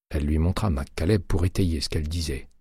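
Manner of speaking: usual speaking voice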